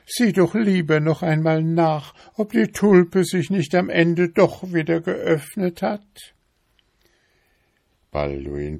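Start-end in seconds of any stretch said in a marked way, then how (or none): 0.1-6.3 high-pitched, fussy wife voice, louder; nagging wife beetle. A narrator's reading. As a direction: pester him in a high, insistent voice.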